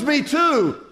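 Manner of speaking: high, loud, eager acted reply; thrilled to find common ground; hammed-up joke-teller excitement; excited agreement. Passerby delighted at match.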